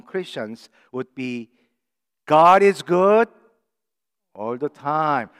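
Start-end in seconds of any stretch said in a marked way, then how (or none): 2.3-5.3 loud, rising call to the church. Shown as a demonstration. Pastor leading the congregation, loud call. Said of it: "let's try to, together".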